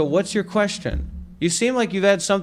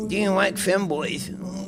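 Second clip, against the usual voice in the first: slow mocking echo; 5 dB quieter, slower than usual. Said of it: "repeats caller's question slowly".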